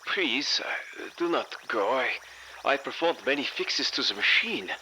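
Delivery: put-on high-pitched, excited German accent; breathless excitement. A narrator's reading. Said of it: "The man was almost breathless with excitement".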